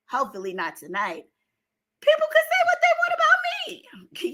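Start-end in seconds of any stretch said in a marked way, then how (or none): 2.0-4.3 strained, squeaky high voice as it gives out; voice going high. Real, not acted. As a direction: voice cracking up high and fast. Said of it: "my voice is getting high 'cause I could barely talk".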